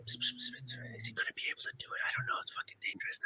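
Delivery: hushed unvoiced gossip; whispering crew. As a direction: whisper like crew doubting behind your back. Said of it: "going like".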